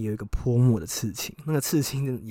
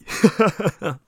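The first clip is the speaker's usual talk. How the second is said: a burst of high laughter; real amused laugh; 9 semitones higher, 8 dB louder than usual. Real, not acted. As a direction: laugh out loud at it. Laughs at own story.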